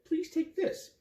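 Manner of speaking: high, pleading offer; lightly voiced kind woman; woman's plea. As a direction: press the gift on him. Woman urges gift.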